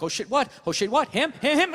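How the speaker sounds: dog voice winding up in pitch; quick questions, building toward an attack; imitated eager dog. Dog looking at its owner for a target, eagerly alert. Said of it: "Dog just looks at me like".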